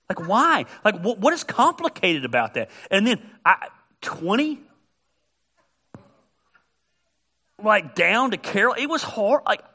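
mock-furious traffic complaint; exasperated rant. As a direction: let the exasperation climb as you vent. Venting about traffic merging.

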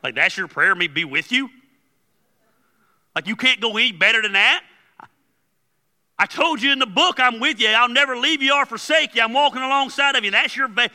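worked up, loud and high, incredulous; God fed up with a weak prayer; building indignant protest; exasperated God. Acted. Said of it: "And God's like".

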